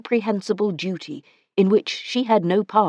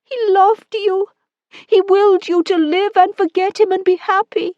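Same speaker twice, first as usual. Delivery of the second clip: much higher and louder, tearful plea; woman weeping as she tells him; 14 semitones higher, 5 dB louder than usual. Acted. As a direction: comfort him through tears.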